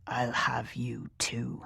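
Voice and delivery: slow whispered threat; murmured whisper. A narrator's reading. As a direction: breathe it out, slow and menacing. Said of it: "he murmured".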